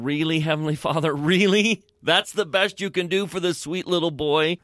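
disbelieving, pleading questions; exasperated plea. Retold or imitated. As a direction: question it in dismay, pitch lifted. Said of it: "he's saying".

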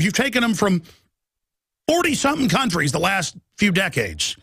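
fired-up broadcaster rant; heated rant. Real, not acted. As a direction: rail at them, pitch climbing. Ranting at government.

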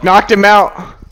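loud, high victory yell; triumphant shout. Real, not acted. Triumphant callout after a knock.

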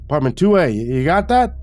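calling out. Acted. Retiree giving the narrator an apartment number, calling out. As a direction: call it out high and loud.

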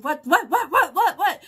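loud, high, rapid-fire panicked yelping; startled volley of whats; imitated freaking out. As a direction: mimic someone else's frantic confusion. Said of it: "And he's freaking out, going".

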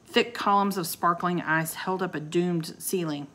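ordinary delivery, nothing marked

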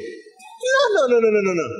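the first no jumps high, then a run of dismissive nos; emphatic rejection. Acted. Preacher rejecting a popular sermon title, no after no. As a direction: wave the slogan away, no after no.

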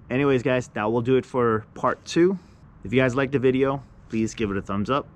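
steady sign-off read; vlog outro. Acted. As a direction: wrap up the video for viewers. Video outro.

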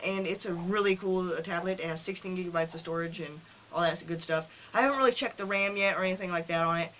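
ordinary talk, nothing marked

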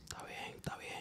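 breathy whisper, barely there; whispered "fine, fine" after the scolding; whispered surrender. Real, not acted. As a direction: give in under your breath. Brushed off after being scolded.

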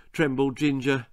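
ordinary delivery

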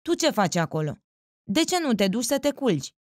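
mild scolding call toward the back of the room; calling across the room. A narrator's reading. Woman calling sharply to the man at the back, mildly scolding. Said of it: "strigă ea".

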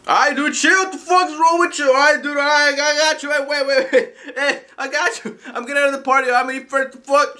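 high, loud gamer squabble; worked-up pleading to back off; agitated protest. Acted. Skit, arguing back in party chat.

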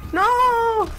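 loud, high, drawn-out yell of protest; alarmed cry. Real, not acted. Player trying to get away, alarmed yell. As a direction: cry out as you get caught. Cornered in the game, cries out.

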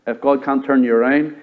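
ordinary talk

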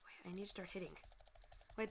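normal talking voice

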